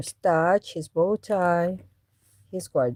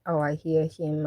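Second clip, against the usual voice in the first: ordinary talk, nothing marked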